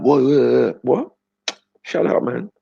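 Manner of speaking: high, mock-aggressive friend; mock aggressive kid. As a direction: ham up the friend's sudden attack. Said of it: "he's like".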